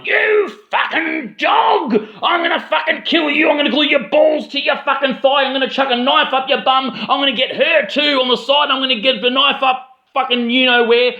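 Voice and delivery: high-pitched imitation of a berating stranger; mock screaming rant. Meth addict berating a bloke on the train, high mock screaming. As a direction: screech threats as a hammed-up raging addict, without real volume. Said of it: "she starts screaming at a bloke".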